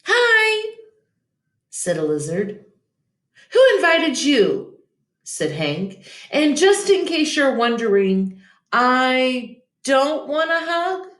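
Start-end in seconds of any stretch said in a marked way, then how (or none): 0.0-0.8 tiny high squeaky hello; lizard voice. Acted. Lizard popping up to greet Hank, high squeaky hello. 3.5-11.1 grumpy. Acted. Grumpy cactus snaps at lizard.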